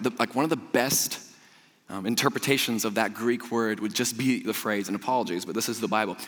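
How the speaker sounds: usual speaking voice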